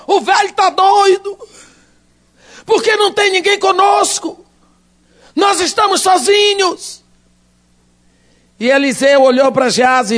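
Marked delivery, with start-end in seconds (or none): 0.1-7.0 frightened complaint. Acted. Panicked servant thinks aloud.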